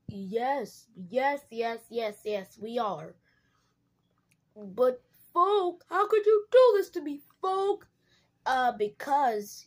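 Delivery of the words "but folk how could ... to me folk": betrayed outcry. Acted. Betrayed character protests.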